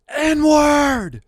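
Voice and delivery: high, drawn-out full-lunged shout; imitated screaming. Storyteller imitating a man screaming in the night street, screaming. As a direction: holler it out loud and high. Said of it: "screaming the N-word on the top of his lungs".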